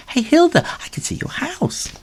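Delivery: high teasing call; high mocking kitten. Kitten jeers at Ginger up the tree, high teasing. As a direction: taunt him from below.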